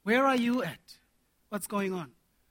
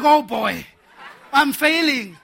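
mock panic, laughing; 7 dB louder, slower than usual. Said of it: "I'm like".

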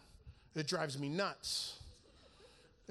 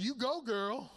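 higher, sassy cheer; playful mock-sassy praise; cheering encouragement; 6 semitones higher, slower than usual. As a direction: hype her up like a cheerleader. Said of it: "And I said".

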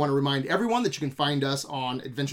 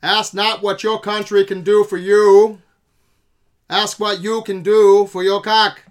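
louder speech-maker voice; old-timey voice; 3 semitones higher, 10 dB louder than usual. Pretend. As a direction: declaim like a 1960s president. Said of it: "You got anything else".

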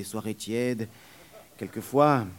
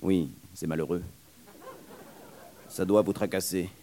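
put-on lightly lowered young man's voice; a hint of a polite young man; 4 semitones lower than usual. Young man answering the nurse, lightly lowered voice. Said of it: "Il prononça".

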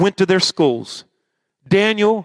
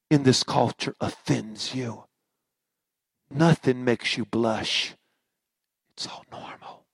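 hushed whisper; 2 semitones lower than usual. Acted. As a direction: lean in and hush it. Hushed warning about compromise.

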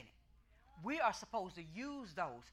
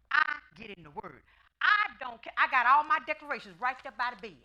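insistent, louder; 4 semitones higher, 11 dB louder than usual. Real, not acted. Preacher insisting.

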